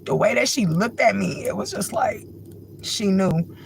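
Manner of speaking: high, cracking voice through real tears; voice breaking. Real, not acted. Recalling the stranger who understood her, voice breaking. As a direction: let the voice crack on the memory. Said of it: "It's nothing to be crying about".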